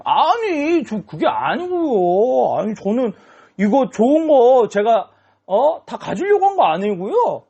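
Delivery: 높고 느리게 늘어지는 억지 변명; 높은 목소리로 쩔쩔매며 변명하기; 변명하는 목소리. Acted. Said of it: "핑계를 댔어요". (사무엘에게 혼나자 높고 느리게 늘어놓는 억지 변명)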